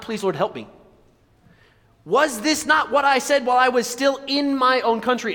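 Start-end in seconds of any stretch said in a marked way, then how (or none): 2.1-5.3 high, indignant shouted complaint; angry Jonah. Acted. Jonah complaining to God in prayer, angry. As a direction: protest hotly, pitched up high. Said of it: "makes me so mad".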